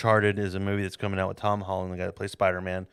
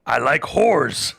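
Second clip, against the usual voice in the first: loud, high comic Bible retelling; boisterous mock-epic narration; hammed-up storytelling; 4 semitones higher, 8 dB louder than usual. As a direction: tell it like a rowdy campfire tale.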